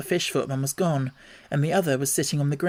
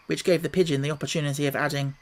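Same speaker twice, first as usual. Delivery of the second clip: usual speaking voice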